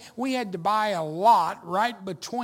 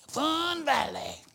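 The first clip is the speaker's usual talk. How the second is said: high, drawn-out mock cheer of a place name; enthusiastic church member; 9 semitones higher, slower than usual. Church member urging the pastor to visit, excited. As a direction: sing out the name like a keen fan.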